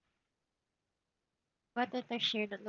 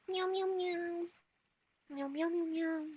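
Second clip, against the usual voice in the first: high cooing meows to the cat; mock meowing; 7 semitones higher than usual. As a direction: call the cat in a higher sing-song.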